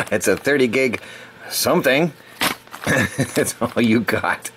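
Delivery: laughing through the words; chuckling at own mix-up. Real, not acted. Laughs at own brain glitch.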